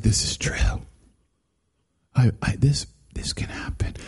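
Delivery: hushed, breathless whisper of a believer; awed whisper. Retold or imitated. Moviegoer whispering in awe at Star Wars. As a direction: whisper it like it's sacred truth.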